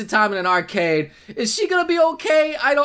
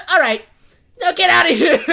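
higher, louder mock order; acted angry dismissal; 4 semitones higher, 5 dB louder than usual. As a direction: shout them out of the room. Voices Miku ordering him out.

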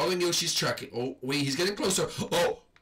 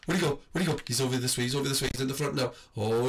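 normal talking voice

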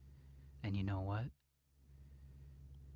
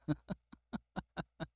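creepy chuckle; 5 semitones higher, 4 dB quieter than usual. Acted. Laughs about lover boy.

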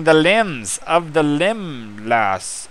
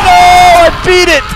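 slow, high, loud victory shout; drawn-out cry of triumph; triumphant shout; 13 semitones higher, 8 dB louder, slower than usual. Real, not acted. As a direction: yell the win out long. Triumphant win in game.

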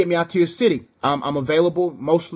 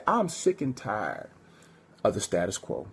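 much lower, much softer, slow; hushed weighty final line; low dramatic close; 7 semitones lower, 8 dB quieter, slower than usual. Acted. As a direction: drop it low and heavy. Sermon's closing punchline.

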